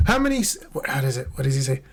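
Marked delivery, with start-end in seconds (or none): none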